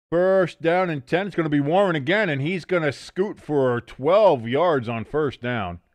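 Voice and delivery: a sportscaster calling the game; play-by-play call. Acted. Gamer calling the play to stream viewers, broadcaster style. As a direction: announce the drive like a TV commentator.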